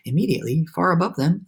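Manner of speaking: plain reading voice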